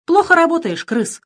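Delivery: high, loud scolding of a servant; pirate queen scolding her henchman, high and loud. A narrator's reading. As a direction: tell him off sharply. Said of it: "сказала королева пиратов".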